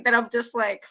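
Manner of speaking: ordinary talk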